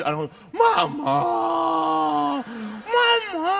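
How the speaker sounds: high, loud hammed-up wail for mum; mock child wailing. Priest mimicking children moved by the song, mock wailing. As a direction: imitate children bawling, stretched and high. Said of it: "membuat anak-anak terharu gampang".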